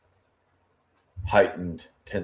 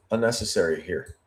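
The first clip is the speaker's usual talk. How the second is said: ordinary delivery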